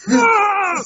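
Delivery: high loud scream of frustration; frustrated scream. Retold or imitated. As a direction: let out a burst of rage. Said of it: "I'm just like".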